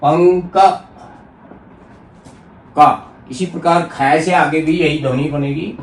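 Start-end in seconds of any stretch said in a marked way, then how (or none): 0.0-2.9 "पंक" broken before its final क; syllable-split demonstration. Shown as a demonstration. Teacher spelling out the word, "पंक" said in separated parts. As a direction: hold the ङ in "पंक", then sound क alone. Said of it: "इसको इस प्रकार भी लिख सकते हैं".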